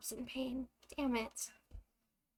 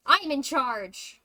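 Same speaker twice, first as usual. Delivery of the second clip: loud, slow declaration; 3 semitones higher, 10 dB louder, much slower than usual. Acted. Android asserting, loud slow.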